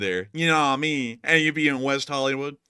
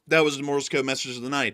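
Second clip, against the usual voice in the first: usual speaking voice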